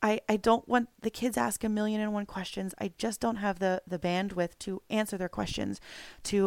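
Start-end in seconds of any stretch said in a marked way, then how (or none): none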